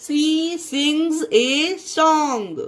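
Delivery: She sings a song, stretched very slowly; very slow demo sentence. Shown as a demonstration. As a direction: spell out each word for learners. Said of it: "उसे एस को जा पढ़ेंगे".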